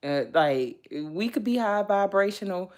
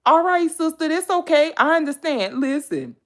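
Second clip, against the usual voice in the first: ordinary talk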